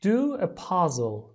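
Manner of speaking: very slow, loud teacher model; slow model phrase. Shown as a demonstration. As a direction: stretch each word for the class. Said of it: "لطفاً تکرار کنید".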